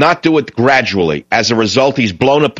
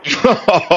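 high chuckle falling away; amused laugh; 7 semitones higher than usual. Real, not acted. Host amused by caller losing her point, amused laugh. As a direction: laugh out at a higher pitch.

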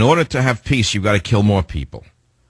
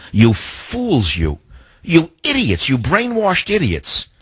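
scornful outburst; 6 semitones higher, slower than usual. Real, not acted. Host berating liberal listeners.

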